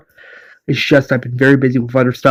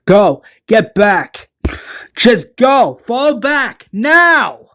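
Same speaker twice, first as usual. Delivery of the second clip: high, drawn-out command; acted calling out, climbing in pitch; urgent order; 8 semitones higher, much slower than usual. Valeria orders the survivors to fall back, urgent.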